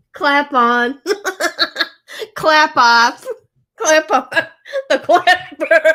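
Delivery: loud jingle-style chant, laughing; mock commercial. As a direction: bark it like an old TV ad, cracking up. Mimicking the Clapper commercial.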